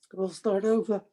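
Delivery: high, breaking voice through real tears; crying. Real, not acted. Quoting his father's answer to the interviewer, crying. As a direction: let the tears break the words. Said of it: "Why does that make you cry?".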